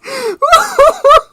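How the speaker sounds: squealing high-pitched cackle; helpless shrieking laughter. Real, not acted. Laughing at own joke.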